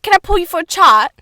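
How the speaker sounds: chirpy mock British accent. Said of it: "you can say".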